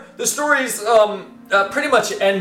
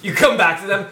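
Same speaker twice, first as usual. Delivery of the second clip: usual speaking voice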